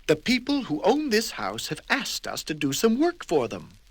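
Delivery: busy little man explaining; prim character voice. Acted. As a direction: explain the job primly. Mister Tidy explains.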